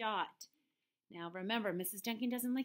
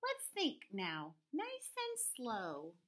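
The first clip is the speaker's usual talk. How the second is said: slow right down to settle everyone; calm farmer; 2 semitones higher, slower than usual. Acted. Farmer settles the squabbling animals, slow and calm. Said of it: "said Farmer Dale".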